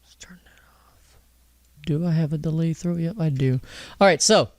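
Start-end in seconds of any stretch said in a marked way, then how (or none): 0.0-1.2 quick whispered aside to himself; whispering. Real, not acted. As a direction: drop to a bare whisper. Muttering to himself mid-session.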